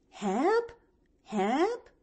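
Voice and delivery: high, rising mock call; imitated husband's rising call. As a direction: call it with an upward swoop. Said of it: "when he calls out".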